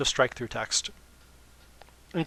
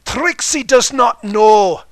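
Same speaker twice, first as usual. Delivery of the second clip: slow, much louder and higher pompous boast; haughty loud declaration; 10 semitones higher, 15 dB louder, much slower than usual. Acted. Trixie insisting on her dignity, loud and pompous. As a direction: proclaim it grandly.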